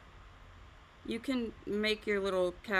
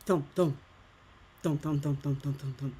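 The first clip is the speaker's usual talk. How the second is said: imitated footsteps; 6 semitones lower than usual. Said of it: "And then I hear".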